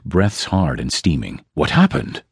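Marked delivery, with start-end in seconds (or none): 1.5-2.2 sharp high demand. A narrator's reading. Master ranger rushing across camp, demanding an answer. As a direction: demand an answer as you rush in. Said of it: "Cornelius demanded".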